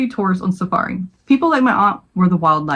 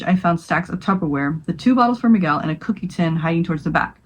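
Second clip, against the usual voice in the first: ordinary delivery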